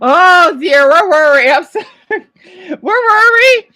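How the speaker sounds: shaken, half laughing, trying to regain composure; flustered laughing. Real, not acted. Recovering, laughing and tearful.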